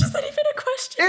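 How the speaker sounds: high, fast eager question; excited squeal. Real, not acted. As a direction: gush at the offer. Eager to see new palette.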